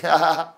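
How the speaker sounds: short loud real laugh; burst of laughter. Real, not acted. Laughing at his own accent confusing Xiao, a short loud real laugh.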